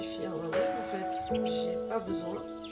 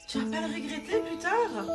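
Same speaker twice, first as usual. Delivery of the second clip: imitated concerned questioner; 7 semitones higher than usual. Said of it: "qu'on me demande souvent".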